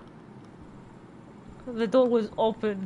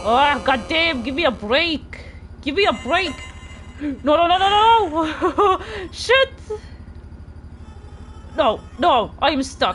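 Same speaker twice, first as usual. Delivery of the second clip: panicked yelling, louder and higher; 6 semitones higher, 5 dB louder than usual. Real, not acted. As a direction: cry out trapped and desperate. Trapped by killer in game.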